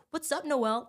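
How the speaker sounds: high-pitched imitated hello to a regular; car wash staff greeting. Car wash workers greeting her dad, high friendly hail. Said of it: "they're like".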